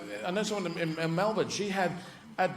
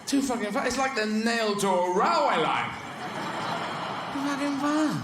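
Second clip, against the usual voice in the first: high, indignant complaint; high-voiced beaten dog griping; exasperated greyhound; 6 semitones higher than usual. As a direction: protest it pitched right up. Greyhound complaining after the race.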